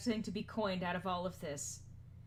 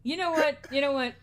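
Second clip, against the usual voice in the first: normal talking voice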